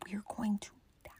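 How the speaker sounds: whispered, fast at the end. Retold or imitated. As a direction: hiss the warning under your breath. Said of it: "whispered warning in car".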